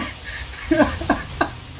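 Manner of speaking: wheezy laugh. Real, not acted. Continued laughter.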